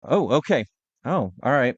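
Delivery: usual speaking voice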